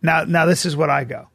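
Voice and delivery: ordinary talk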